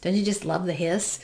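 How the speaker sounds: usual speaking voice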